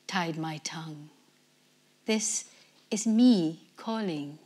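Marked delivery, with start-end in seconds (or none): 0.1-1.1 breathy unvoiced whisper. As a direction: barely breathe the words. Poem of a ghost's voice.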